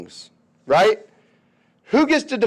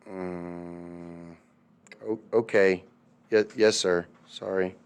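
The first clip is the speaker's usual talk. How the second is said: much lower and softer, timid stammer; meek surrender; 10 semitones lower, 10 dB quieter, slower than usual. Retold or imitated. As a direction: back down sheepishly. Europe meekly backing down.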